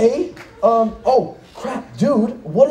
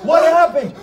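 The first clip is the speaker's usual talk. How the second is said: shouting; 7 semitones higher, 4 dB louder than usual. Acted. Shouted question.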